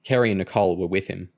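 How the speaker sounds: ordinary delivery, nothing marked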